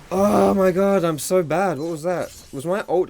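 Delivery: high, loud, disbelieving cry at his own mistake; shocked outburst. Real, not acted. Misses his ultimate, shocked.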